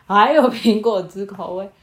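plain reading voice

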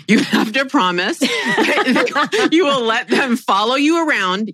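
high, drawn-out words broken by laughter; slow down and squeeze it out through giggles; trying not to laugh. Real, not acted. Retelling partner's condition for an assistant, laughing through it. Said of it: "trying to say this without laughing".

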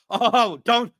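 high, loud mock protest; mock their complaint; mocking whine. Mocking complaint about play action.

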